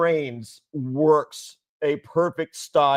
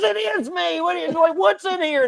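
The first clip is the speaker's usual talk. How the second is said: put-on much higher, shouted old-lady squawk; angry grandma. Imitating grandma yelling at the costume. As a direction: yell it like the cranky grandma. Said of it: "she goes".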